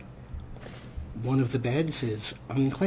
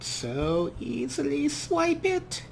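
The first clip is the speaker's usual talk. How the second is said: greedy tease; slower than usual. Acted.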